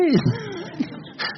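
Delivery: high amused laugh after the joke. Real, not acted. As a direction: laugh at your own impression. Risa tras la imitación. (risa aguda y divertida)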